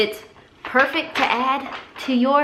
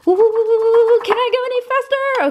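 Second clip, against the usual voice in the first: excited whisking; 12 semitones higher than usual. Real, not acted. Whisking matcha as fast as possible.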